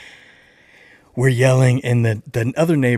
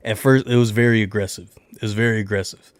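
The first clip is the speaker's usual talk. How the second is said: usual speaking voice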